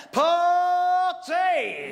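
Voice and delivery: a loud cheer of party. Retold or imitated. Friend cheering to win him over, loud party cheer. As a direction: throw out party like a shout.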